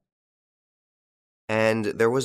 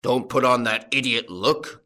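loud growling rebuke; gruff, forceful farmer; angry growl; 2 semitones higher, 5 dB louder than usual. A narrator's reading. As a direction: growl it at a faker. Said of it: "growled the farmer".

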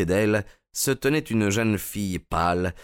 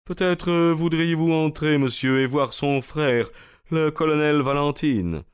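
hesitant butler; 8 semitones higher than usual. A narrator's reading. Butler voiced by narrator.

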